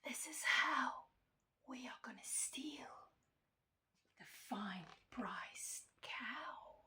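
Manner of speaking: secretive, very soft whisper; hushed conspirators sharing a plan; whispering thieves. A narrator's reading. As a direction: whisper the plot slowly. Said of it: "she heard them whisper".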